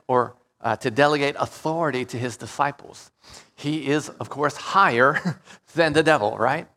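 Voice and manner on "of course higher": ordinary delivery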